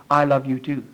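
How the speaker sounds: low, soft loving answer; the Father's tender reply. Acted. Father embracing his child answers, tender reply. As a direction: drop the voice deep and quiet, reassuring. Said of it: "the Father puts his arms around me and says".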